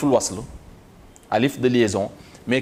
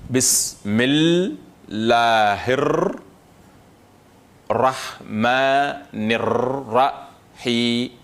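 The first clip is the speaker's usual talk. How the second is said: very slow, chanted Bismillah; Quranic recitation; much slower than usual. Shown as a demonstration. Teacher repeats the reading for students, slow chant. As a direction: stretch Rahmanir out long and melodic. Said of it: "On va répéter la lecture".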